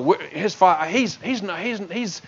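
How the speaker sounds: mock the crowd's murmured suspicion quietly; muttered doubts. Preacher voicing the crowd's whispered doubts about Jesus, hushed mutter. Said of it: "are thinking".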